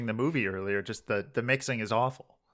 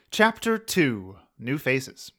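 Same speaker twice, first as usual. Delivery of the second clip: announcer voice; 2 semitones higher, 4 dB louder, much slower than usual. Acted. Fan fiction reading announcer.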